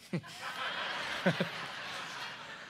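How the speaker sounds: quiet breathy laugh after the punchline; soft laugh. Real, not acted. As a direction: chuckle under your breath at your own joke.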